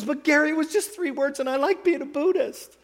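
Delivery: high, pleading woman's voice; high-pitched retold plea; imitated upset protest. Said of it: "She was crying".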